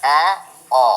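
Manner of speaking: ए and ओ said slowly and clearly; problem vowel sounds. Shown as a demonstration. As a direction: model each vowel distinctly. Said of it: "ये दो sound आप लोग का problem".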